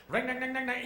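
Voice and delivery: very high, loud clanging; imitated revving engine. Driver racing the engine for the mechanic, imitated clatter. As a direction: mimic an engine screaming at full revs. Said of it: "hear a funny noise in the engine".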